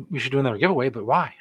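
usual speaking voice

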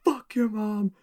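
high-pitched mock-furious sign-off; high imitated rant at a crowd; retold outburst.

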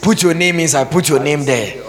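high, loud preacher's cry; mock prophet shout. Pastor mocking a prophet shouting at the congregation. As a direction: bellow it like a fiery prophet.

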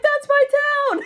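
high, slow squeal of delight; thrilled high yelp, drawn out; excited shout. Retold or imitated. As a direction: shriek it out like you just saw your hometown on TV. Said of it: "and I was like".

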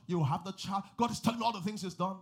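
loud, high sudden preacher's cry; preaching burst. Acted. Pastor proclaiming to the couple, loud and high. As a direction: burst out with conviction.

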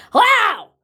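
a loud, high barked hello as dad said it; angry yell. Retold or imitated. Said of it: "my dad going".

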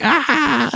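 high squealed delighted "Ah!"; mock happy shriek. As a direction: imitate Andy's burst of joy. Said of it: "And he's like".

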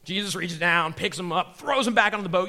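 ordinary talk, nothing marked

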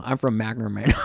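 mock North Highlands local twang on "Sacramento". Mocking a North Highlands local's way of saying "Sacramento". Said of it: "somebody from, like, North Highlands pronouncing".

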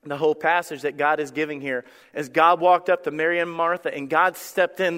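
ordinary talk

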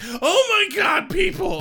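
high, loud, drawn-out groan at people; mock-horrified high shout; mock exasperation. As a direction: wail it in hammed-up dismay. Angel exasperated by parents.

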